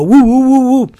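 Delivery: high, loud whooping chant; Arsenio crowd whoop. Retold or imitated. Fan imitating the Arsenio Hall audience, loud whoop. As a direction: whoop it up like a talk show crowd. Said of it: "twirl around their fist and go".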